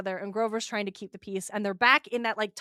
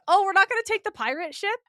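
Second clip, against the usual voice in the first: mock surprise; 9 semitones higher, 4 dB louder, faster than usual. Mock disbelief at the pirate ship.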